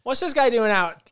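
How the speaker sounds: startled dad. Retold or imitated. Dad reacting to his son coming home, mock startled outburst. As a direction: imitate a dad blurting out in disbelief.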